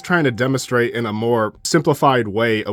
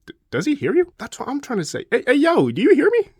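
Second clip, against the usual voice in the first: much higher, incredulous squeak; baffled calling out; 13 semitones higher, faster than usual. Acted. Galba unheard by the Emperor, baffled high-pitched calling. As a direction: pitch it way up, trying to get heard.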